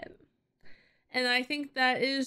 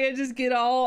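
usual speaking voice

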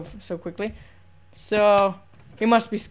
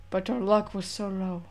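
ordinary talk

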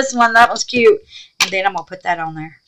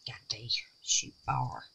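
ordinary talk, nothing marked

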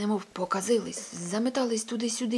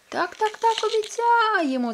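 much higher, squawking hen chorus; clucking hens; 14 semitones higher, 8 dB louder than usual. Acted. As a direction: cackle the promise like excited hens. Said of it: "загаласували кури".

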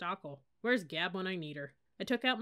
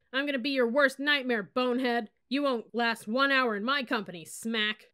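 loud, higher shouted threats; hostile yell; 4 semitones higher, 6 dB louder than usual. Acted. Angry crowd yelling threats at the cadets. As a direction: bark it in their faces.

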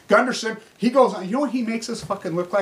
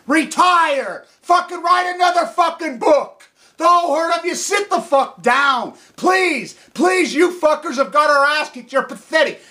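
angry yelling; 5 semitones higher than usual. Real, not acted. Telling the old lady to retire, shouting. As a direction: yell retire at her.

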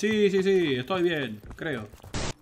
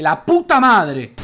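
slow, loud furious curse; drawn-out angry curse; 2 semitones higher, 10 dB louder, much slower than usual. Real, not acted. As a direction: drag out the curse in rage. Gamer cursing loudly.

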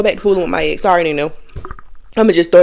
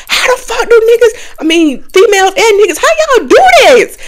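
high, louder, disbelieving cry; incredulous outburst; 13 semitones higher, 5 dB louder than usual. Retold or imitated. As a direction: throw the question up in disbelief. Retold outburst to herself.

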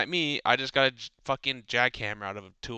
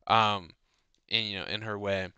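normal talking voice

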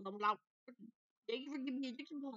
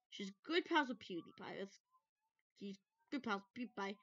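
ordinary talk, nothing marked

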